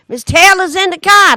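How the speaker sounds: high loud retold scream of the kids. Retelling panicked kids calling out, high mock yell. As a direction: shout it like a panicked schoolkid.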